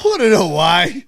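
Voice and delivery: hammed-up outraged dad; mock angry dad. Dad ordering son to cover up, slow and loud.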